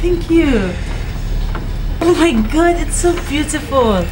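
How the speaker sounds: high, bright gushing thanks; delighted. Acted. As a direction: squeal over the gift. Receiving a gift, delighted.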